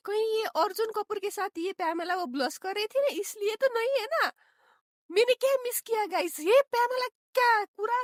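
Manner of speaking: high-pitched, loud astonished outburst; shocked disbelief. Real, not acted. Viewer reacting to Chamola backbiting Pyamla, shocked. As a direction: exclaim in disbelief, voice pitched right up.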